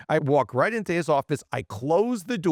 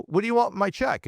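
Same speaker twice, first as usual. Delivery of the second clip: higher, faster, caught off guard; startled protest; 7 semitones higher, faster than usual. Retold or imitated. Young salesman asks the manager why he wants the paycheck, startled, higher and quicker. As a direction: blurt it out in alarm. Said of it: "And I go".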